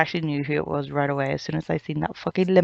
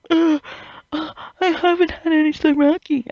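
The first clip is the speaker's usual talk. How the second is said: much higher, whiny put-on voice; whiny mock fan; 12 semitones higher than usual. Mocking the story's demand for more Zaraki, whiny. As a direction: whine like a spoiled kid denied a treat. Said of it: "But then it was like".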